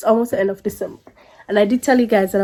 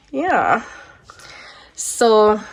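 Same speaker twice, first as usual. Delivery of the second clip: ordinary talk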